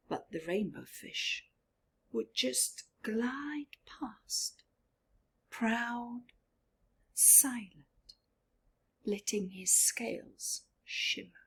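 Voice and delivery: lively read-aloud to a class; storybook narration. A narrator's reading. Teacher reading to her class, lively storybook voice. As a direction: read it like a teacher with picture book.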